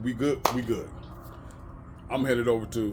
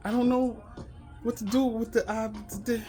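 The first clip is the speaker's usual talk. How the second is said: much higher, hesitant stammering manager; put-on high voice, halting and baffled; mocking manager impression; 12 semitones higher than usual. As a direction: mimic a flustered manager in a high voice. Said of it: "This motherfucker say to me".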